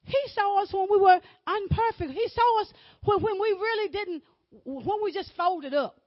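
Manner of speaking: loud, high preacher's cry; rising sermon shout; full-force preaching. Acted. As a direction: preach it out with fire.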